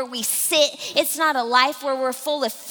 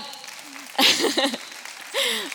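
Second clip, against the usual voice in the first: high, amused laugh; real laugh; 8 semitones higher than usual. Real, not acted. Speaker amused at herself before the congregation, laughing. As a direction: laugh at your own aside. Laughs at her own remark.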